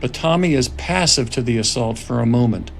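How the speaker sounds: measured screenplay narration; script narration. A narrator's reading. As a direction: read the scene directions steadily. Reads screenplay narration aloud.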